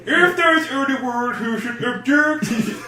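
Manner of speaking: slow, high, hammed-up Swedish wedding speech; Swedish Chef. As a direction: drag it out in high mock Swedish. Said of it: "there is a Swedish Chef slash fiction".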